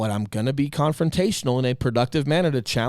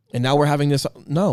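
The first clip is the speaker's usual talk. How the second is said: normal talking voice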